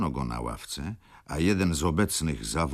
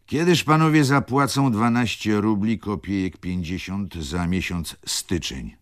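judge asking; 5 semitones higher, 6 dB louder than usual. A narrator's reading. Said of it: "spytał sędzia".

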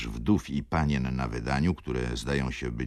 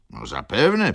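bass cutting in; 6 semitones higher, 8 dB louder than usual. A narrator's reading. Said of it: "przerwał bas".

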